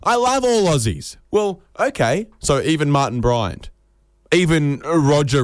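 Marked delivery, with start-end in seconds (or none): 0.0-1.2 high, stretched-out adoration; gushing declaration. Acted. Foreigner professing love for every Australian, high gushing declaration. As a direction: gush it high, drawing out the words. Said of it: "pull up people when they say".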